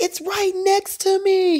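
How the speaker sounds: high, slow frightened cry; terrified. Retold or imitated. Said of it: "he was like".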